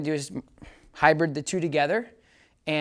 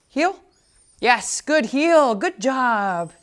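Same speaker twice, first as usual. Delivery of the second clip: high, loud, singsong good heel; excited dog praise; 8 semitones higher, 7 dB louder, much slower than usual. Real, not acted. Trainer praising his puppy on the heel, high excited praise. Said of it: "using a lot more healing, good heel".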